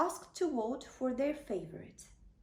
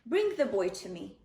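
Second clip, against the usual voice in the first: lower, firm prophet's command; commanding Samuel; 5 semitones lower, 4 dB louder than usual. Acted. Samuel tells Jesse to bring David, lower and commanding. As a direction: give the order with calm authority.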